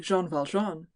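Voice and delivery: usual speaking voice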